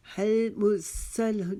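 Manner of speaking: slow हल मुरसल with unreleased l; careful model reading of हल मुरसल; recitation demo. Shown as a demonstration. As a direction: chant हल मुरसल, closing each l cleanly. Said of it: "जल्दी से इसको पढ़ लेंगे".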